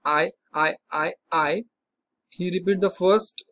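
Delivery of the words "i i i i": stammering. Shown as a demonstration. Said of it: "Like if you want to say".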